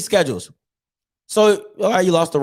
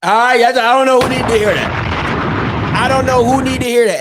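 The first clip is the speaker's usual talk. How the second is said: louder, higher, punching the line out; loud sign-off; 5 semitones higher, 5 dB louder than usual. Real, not acted. Vlogger calling out to the haters, loud. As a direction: shout it to whoever needs it. Loud tag after the clip.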